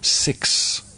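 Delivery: soft, hushed dictation; very quietly. Real, not acted. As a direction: say each digit softly. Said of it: "I'll say it very quietly now".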